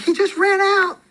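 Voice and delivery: shrill-free high-pitched panic as the wife; panicked wife. Retold or imitated. Wife scolding her mom over the escaped kid, high and alarmed. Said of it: "She's like".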